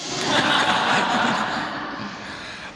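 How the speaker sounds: quick airy chuckle. Real, not acted. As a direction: laugh lightly at your own joke. Speaker laughs at his own joke.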